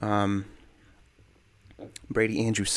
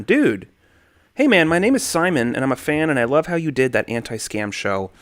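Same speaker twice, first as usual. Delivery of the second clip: usual speaking voice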